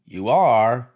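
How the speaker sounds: loud disbelieving question; can't believe it; host doubting his guest; incredulous. A narrator's reading. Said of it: "looked at her incredulously".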